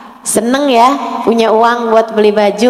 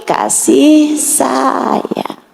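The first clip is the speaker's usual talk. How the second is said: very slow; much slower than usual. Acted. Preacher lingers on love.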